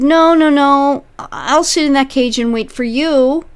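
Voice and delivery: high, pushed-out insistence; mock pleading man. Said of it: "but you said".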